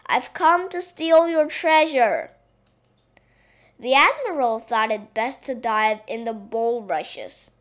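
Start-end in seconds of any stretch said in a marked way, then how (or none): 0.1-2.3 loud, higher princess playing pirate; calling out. A narrator's reading. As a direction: announce the raid in a raised voice. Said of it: "called out the little princess".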